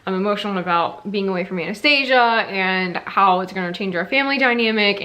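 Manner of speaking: ordinary talk, nothing marked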